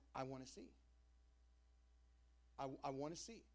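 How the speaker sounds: soft, low longing; quiet plea. Retold or imitated. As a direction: plead it softly. Blind man pleads with Jesus.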